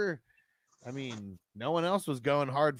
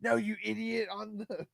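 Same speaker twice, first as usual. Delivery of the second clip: high, loud yelled insult; mock scream; 11 semitones higher, 3 dB louder than usual. Quoting the movie, yelling at his buddy. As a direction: bark it like an exasperated stoner. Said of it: "screams it out the fucking van".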